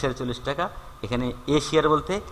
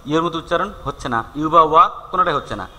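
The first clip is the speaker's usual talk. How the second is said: normal talking voice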